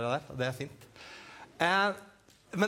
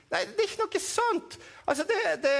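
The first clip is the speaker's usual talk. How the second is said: puppet Edvin; 14 semitones higher than usual. Acted.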